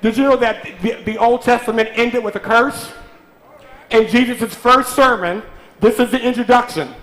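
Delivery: loud, high preaching over the church; full-force preaching. Acted. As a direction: drive the blessing home, full voice.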